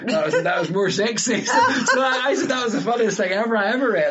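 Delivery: laughing high and loud through it; cracking up while telling it. Real, not acted. Laughing at the funny research.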